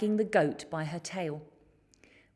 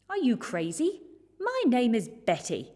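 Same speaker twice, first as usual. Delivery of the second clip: loud, higher indignant snap; furious monster; 5 semitones higher, 4 dB louder than usual. A narrator's reading. Monster Betty correcting George, loud furious retort. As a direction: monster snapping back in offended fury. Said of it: "with a furious gaze".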